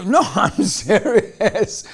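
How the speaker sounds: slow, laughing through the words; laughing reassurance. Retold or imitated. Speaker answering the stunned girl, slow and laughing. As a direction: chuckle it out slowly, amused. Laughing reply to her disbelief.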